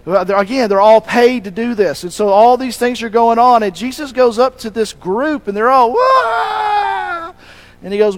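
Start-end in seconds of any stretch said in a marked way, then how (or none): 5.9-7.3 high, drawn-out mock wail. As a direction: imitate hired mourners with one long high "waaah". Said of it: "they would have been screaming and wailing".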